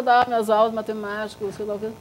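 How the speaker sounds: ordinary talk, nothing marked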